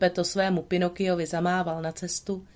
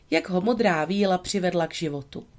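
plain reading voice

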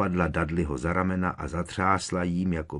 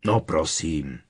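ordinary delivery

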